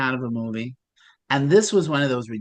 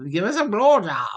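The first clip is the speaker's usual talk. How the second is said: put-on higher-pitched, shaky stab at an Irish accent; attempted Irish accent; 6 semitones higher than usual. Husband's punchline in the old-couple joke, attempted Irish accent. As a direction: have a go at Irish, badly. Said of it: "do this in an Irish accent".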